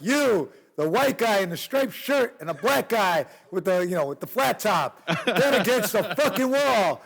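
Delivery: mock cop hollering at two kids; cop yelling. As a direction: yell it like a furious cop. Cops yelling again.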